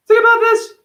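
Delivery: emphatic shout. Real, not acted. Urging listeners to think, high loud shout. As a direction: yell it to grab attention. Preacher pressing the point.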